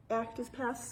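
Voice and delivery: normal talking voice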